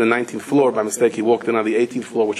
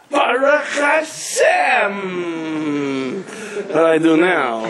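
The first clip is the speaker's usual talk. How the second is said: overwhelmed cry; 7 semitones higher, much slower than usual. Retold or imitated. Father-in-law hearing she's alive, crying out. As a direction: wail it out, stretched and high.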